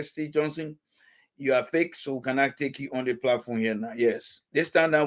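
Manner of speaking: ordinary delivery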